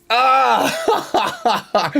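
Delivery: high, sharp yelp of frustration; frustrated yelp. Real, not acted. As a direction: yelp up high at the bad move. Frustrated over the chart.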